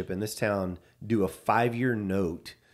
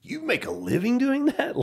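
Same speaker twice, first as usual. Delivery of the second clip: high, incredulous banker voice; a banker amazed anyone earns a living this way; dumbfounded; 3 dB louder than usual. Retold or imitated. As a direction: squeak it out in disbelief. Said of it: "he's dumbfounded".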